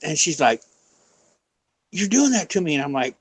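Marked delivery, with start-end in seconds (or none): 1.9-2.7 high-pitched mock accusation; neighbor tripping out. Neighbor accusing her of making the voices, imitated as tripping out. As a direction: retell her spooked blaming, pitched up. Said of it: "she starts tripping out".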